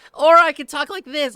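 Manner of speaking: high and loud, voice pushed way up; squeaky high loud voice. Shown as a demonstration. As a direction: show off the top of the range.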